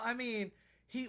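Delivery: ordinary talk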